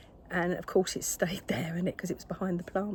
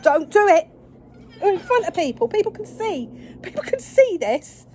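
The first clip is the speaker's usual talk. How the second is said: much higher and louder, exasperated; exasperated loud plea; 11 semitones higher, 11 dB louder than usual. Real, not acted. Owner pleading with her attacking cat, much higher and louder.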